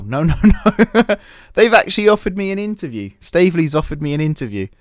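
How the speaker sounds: high, loud delighted laughter through the words; giddy disbelief, laughing it out; laughing excitedly. Real, not acted. Gamer laughing with glee at a surprise interview offer.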